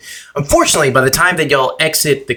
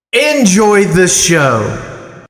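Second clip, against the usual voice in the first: both voices in unison; 6 semitones higher, much slower than usual. A narrator's reading. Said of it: "As in unison, they say".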